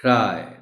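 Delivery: teacher modelling the correct vowel in cry; pronouncing cry. Shown as a demonstration. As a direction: cry said with a long i, like the English word cry. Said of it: "the correct pronunciation of the word is".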